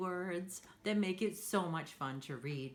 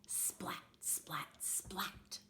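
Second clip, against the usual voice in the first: whisper; 2 semitones lower, 9 dB quieter, much slower than usual. Acted. Lightning sound in story.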